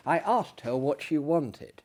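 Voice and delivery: ordinary delivery, nothing marked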